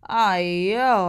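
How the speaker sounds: loud exasperated ay yo; grumbling groan. Acted. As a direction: groan it out in complaint. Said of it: "I grumbled".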